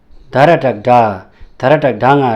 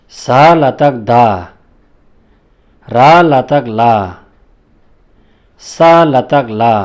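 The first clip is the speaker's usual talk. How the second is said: slow, louder, carefully spaced syllables; spelling drill; 6 dB louder, slower than usual. Shown as a demonstration. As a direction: call out each cluster like a teacher at the board. Teacher recites letter drill. (धीमा, ज़ोरदार वर्ण-उच्चारण)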